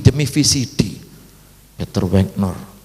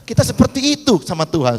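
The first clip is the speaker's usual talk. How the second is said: ordinary delivery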